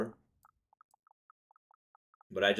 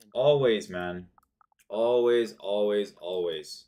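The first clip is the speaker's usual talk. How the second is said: bitter frustration; 2 semitones higher, 8 dB louder, slower than usual. Real, not acted. Bitter repeat after loss.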